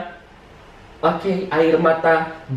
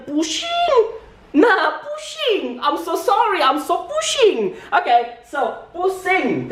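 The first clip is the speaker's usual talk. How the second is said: high-pitched, drawn-out comic whine; hammed-up overwhelmed singsong, stretching the words; mock dizzy wail; 10 semitones higher, much slower than usual. Comic complaint that grammar makes him dizzy. (pura-pura pusing, merengek tinggi)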